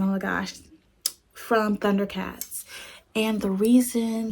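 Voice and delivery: normal talking voice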